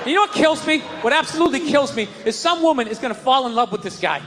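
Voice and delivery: ordinary talk, nothing marked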